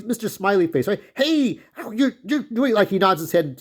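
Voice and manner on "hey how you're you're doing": high, bubbly, over-eager greeting; a chirpy imitation of Gerard; cheery guy imitation. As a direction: mimic a grinning people-pleaser. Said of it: "he's that cheery, cheery guy".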